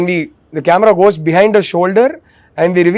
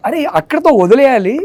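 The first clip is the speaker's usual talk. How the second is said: excited insistence; 6 semitones higher, much faster than usual. Retold or imitated. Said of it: "I was like".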